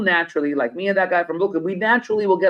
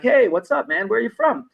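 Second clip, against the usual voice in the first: excited greeting; 7 semitones higher than usual. Retold or imitated. Greeting fellow Brooklynite on bus.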